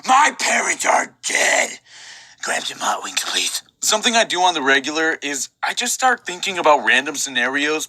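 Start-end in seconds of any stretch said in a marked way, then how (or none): 0.1-1.8 dramatic shout. Acted. 2.4-3.6 whispering. Acted. Whispered aside in scene.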